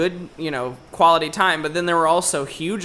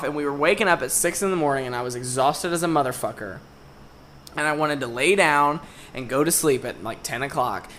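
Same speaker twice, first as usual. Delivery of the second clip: softer, lower, tired recounting; 5 semitones lower, 6 dB quieter than usual. Real, not acted. Telling a friend about the tiring trip, quietly worn out. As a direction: let it sag with exhaustion. Recalling being exhausted.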